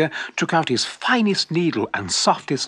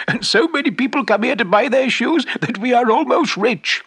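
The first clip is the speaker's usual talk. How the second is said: happy wife; 11 semitones higher than usual. Acted. Happy wife telling her husband they are almost rich, delighted. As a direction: burst out with joy. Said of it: "said the shoemaker's happy wife".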